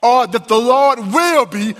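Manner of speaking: high, ringing preacher's cry; full-force pulpit preaching; impassioned preaching. Acted. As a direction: lift the congregation with rising fervor. Preaching, building to the I am.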